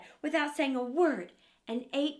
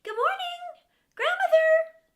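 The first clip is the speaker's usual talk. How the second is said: high, loud cheerful call; girl greeting her grandmother on arrival; 10 dB louder than usual. Acted. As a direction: call out into the house. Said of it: "She cried".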